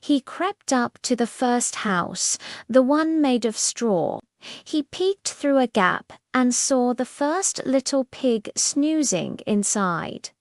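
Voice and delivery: ordinary delivery, nothing marked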